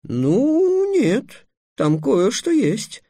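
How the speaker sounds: high, drawn-out hesitant reply; squeaky, wavering excuse; mumbling, unsure. A narrator's reading. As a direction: falter upward as if stalling. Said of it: "промямлил Трикс".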